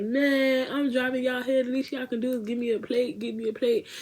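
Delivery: lightly voiced driver asking for food; mock demanding driver. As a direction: whine for a plate like the driver.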